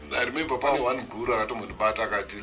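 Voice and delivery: ordinary talk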